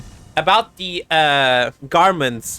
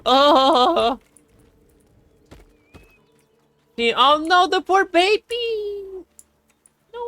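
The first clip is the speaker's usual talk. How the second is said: much higher, slow cooing squeal; squealing pity; much slower than usual. Real, not acted. Sees the hurt baby animal.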